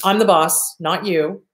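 ordinary delivery

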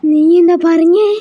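loud, slow, outraged what-did-you-say; shocked disbelief. Acted. Friend stunned by the odd announcement, loud and slow disbelief.

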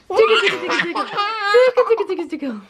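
high squealy tickle chant; giggly tickle attack. Real, not acted.

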